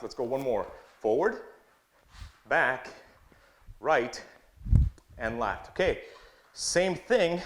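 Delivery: rhythmic drill calls; coach calling drills. Acted. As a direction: count the steps out like a coach.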